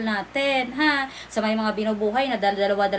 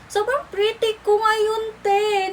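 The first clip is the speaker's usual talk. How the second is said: mock tearful awe; 9 semitones higher, slower than usual. Admiring herself, high tearful tone.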